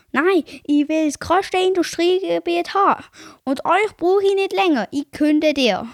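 hammed-up greedy boss firing a worker; imitating greedy boss. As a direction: bark the dismissal like the boss. [name] voices Karkaroff firing him.

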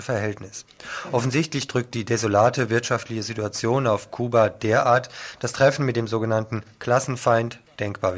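steady radio news reading. Acted. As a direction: read the headlines like a radio presenter. Reads the short news items.

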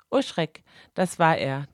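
plain reading voice

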